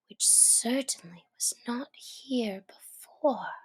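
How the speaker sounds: soft whispered aside; softly whispered surprise. A narrator's reading. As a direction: hush it as a secret. Alice remarks on bottle.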